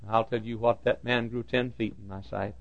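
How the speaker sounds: ordinary talk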